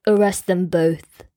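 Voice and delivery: low, commanding king voice; king's decree. A narrator's reading. King ordering his guards, low and firm. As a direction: declare it like a ruler passing sentence. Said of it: "he declared".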